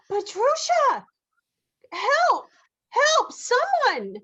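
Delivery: high, loud panicked shouts; shouting for help. Acted. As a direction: cry out for help in alarm. Mother calls for help.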